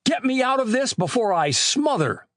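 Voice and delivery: high, urgent plea; clamoring for help. A narrator's reading. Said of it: "he clamored".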